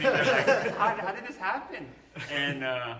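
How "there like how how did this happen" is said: laughing disbelief; giggly stunned question. Retold or imitated. Retelling shock, laughing.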